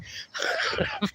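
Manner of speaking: high breathless wheeze of laughter; wheezing laugh. Real, not acted. Wheezing laugh at Hugo's garbled chat message. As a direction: dissolve into a silent wheeze. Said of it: "You talking gibberish".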